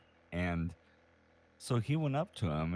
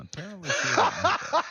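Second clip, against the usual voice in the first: high, loud, laughing through the words; can barely get it out for laughing; 11 dB louder, much faster than usual. Real, not acted. Telling a friend the punchline, laughing hard.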